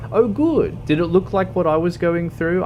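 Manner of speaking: high, loud sarcastic oh good; mock cheerful sarcasm. Real, not acted. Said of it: "I'm curious".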